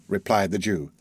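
ordinary delivery, nothing marked

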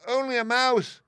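high, loud, offended mouse cry; indignant squeak. A narrator's reading. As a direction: squeak out your outrage. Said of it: "cried the little animal indignantly".

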